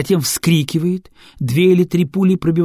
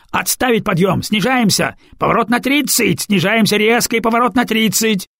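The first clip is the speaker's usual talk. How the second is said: higher, louder barked orders; commanding shout; 7 semitones higher, 4 dB louder than usual. Acted. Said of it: "отданные таким тоном".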